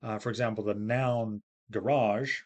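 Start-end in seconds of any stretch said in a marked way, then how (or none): 1.7-2.4 ga-RAGE, second syllable drawn out; garage stressed at the end. Shown as a demonstration. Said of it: "has stress on the second syllable".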